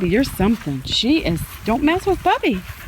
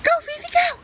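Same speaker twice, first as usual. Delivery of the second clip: high-pitched go-go cheer for the pet; excited high cheer; faster than usual. Real, not acted. Cheering the dog on.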